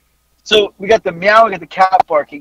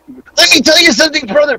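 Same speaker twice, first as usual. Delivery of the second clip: mock failed wrestler impression, louder and higher; bad Hulk Hogan; 8 semitones higher, 5 dB louder than usual. Fan's terrible Hulk Hogan impression, in his same voice. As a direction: imitate someone doing a hopeless Hogan. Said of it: "this guy just proceeds to go".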